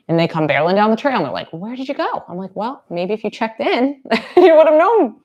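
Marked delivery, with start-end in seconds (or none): 1.5-2.2 high-pitched dog's question; higher, wondering dog voice. Acted. Voices dogs catching up to her. 2.6-5.2 teasing scold rising high and loud; playful telling-off, louder and higher; mock scolding. Mock scolding of her dogs.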